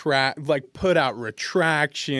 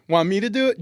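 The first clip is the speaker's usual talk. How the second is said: offering eagerly; 7 semitones higher, 7 dB louder, faster than usual. Retold or imitated. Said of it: "I'm like".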